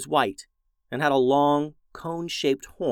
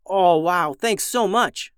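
high, loud grateful cry; a thrilled kid thanking; excited; 7 semitones higher, 4 dB louder, slower than usual. Acted. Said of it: "said June".

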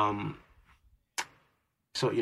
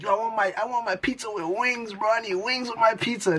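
high, softer mocking imitation; mimicked cocky demand; 7 semitones higher, 4 dB quieter than usual. Gamer imitating opponents' trash talk, mock demanding. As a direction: imitate a trash-talker, pitched up and light.

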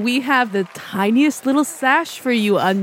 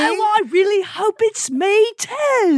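plucky hopeful orphan; 8 semitones higher than usual. Acted. Echoes the orphan voice.

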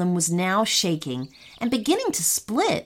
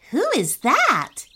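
higher-pitched puzzled question; wondering aloud; 7 semitones higher than usual. A narrator's reading. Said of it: "Keon wondered out loud".

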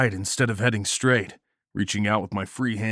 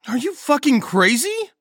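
high, loud, panicked question; alarmed high-pitched outburst; incredulous shout; 5 dB louder than usual. A narrator's reading. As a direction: shriek it in disbelief. Frightened Nagel challenges plan while fleeing.

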